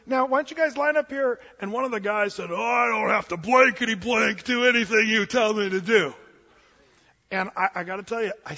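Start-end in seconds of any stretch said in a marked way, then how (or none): 0.0-1.4 imitated sweet gal, pitched high; politely herd the group in a lifted voice; sweet wedding coordinator. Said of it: "she's the sweetest gal in the world". 2.5-6.1 loud mock-belligerent retort; defiant groomsman. Acting a drunk groomsman's defiant retort.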